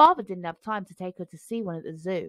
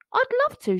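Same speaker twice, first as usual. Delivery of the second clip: put-on high, bright little girl's yes; a child's high eager reply; 3 dB louder than usual. A narrator's reading. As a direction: squeal it, thrilled to be asked. Excited girl answers.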